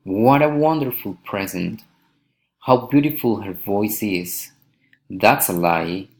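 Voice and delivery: exclaim, dropping to the end on "present", "is", "lie"; falling exclamatory intonation. Shown as a demonstration. Teacher models exclamations, falling on "present" and "lie". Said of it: "falling intonation".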